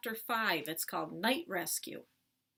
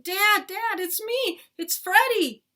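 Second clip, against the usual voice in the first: high, loud panicked scream; 12 semitones higher, 13 dB louder, slower than usual. A narrator's reading. Boy identifying himself to his charging dad, screaming. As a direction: scream to be recognised in time. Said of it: "Then I started screaming".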